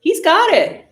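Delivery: loud, high-pitched fan objection; real worked-up shout at the screen; insistent protest. Real, not acted. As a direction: insist loudly at the referee's call. Disputing the spot at the screen.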